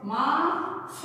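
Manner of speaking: माफ very slowly, split before its f; nukta f sound. Shown as a demonstration. Teacher breaking down the word, माफ slowly ending in f. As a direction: stretch माफ out to a separate f. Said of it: "नुक्ता लग जाता है तो उच्चारण क्या".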